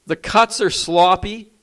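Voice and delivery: usual speaking voice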